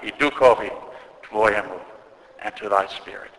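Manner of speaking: ordinary talk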